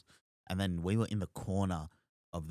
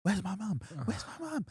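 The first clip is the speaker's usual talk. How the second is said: climbing higher in hammed-up distress; acted mini freak-out; 12 semitones higher than usual. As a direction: play a lost child's rising panic. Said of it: "have this mini freak".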